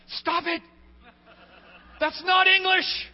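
high, very loud outburst; exasperated shout. Acted. Preacher acting out his urge at filler-word users, exasperated shout. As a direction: explode in hammed-up exasperation. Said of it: "It's what I want to do".

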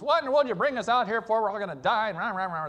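high, loud, hurried mock griping; mock grumbling that runs into babble; upset complaining crowd. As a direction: imitate a mob crying out in complaint. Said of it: "get upset and they're crying out".

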